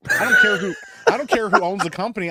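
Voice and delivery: loud, fast exasperated rant; exasperated outburst. Real, not acted. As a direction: blurt it out fed up. Burger rant.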